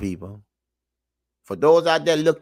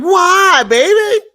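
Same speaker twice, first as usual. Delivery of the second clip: high, loud mock pity; mock sympathy; 12 semitones higher, 7 dB louder, much slower than usual. Host answering the imagined woman, mock sympathy. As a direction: coo it sarcastically.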